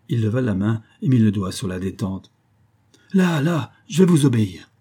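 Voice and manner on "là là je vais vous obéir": high, loud surrender; panicked yielding, pitched up; frightened cry. A narrator's reading. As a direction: give in hastily with a raised cry. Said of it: "cria Bellingham".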